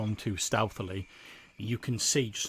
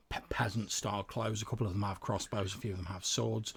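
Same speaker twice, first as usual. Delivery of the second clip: ordinary delivery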